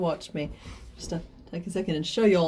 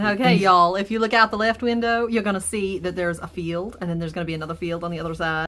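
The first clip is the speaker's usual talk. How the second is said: southern tour guide; 4 dB louder than usual. Pretend. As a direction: greet the passengers Southern-style. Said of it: "remember he's southern".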